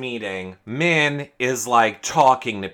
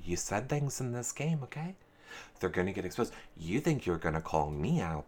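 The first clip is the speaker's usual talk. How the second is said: low, soft, patronizing warning; hushed, lower, condescending imitation; Kyle's condescension; 7 semitones lower, 15 dB quieter than usual. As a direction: talk down to them with quiet menace. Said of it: "condescending thing".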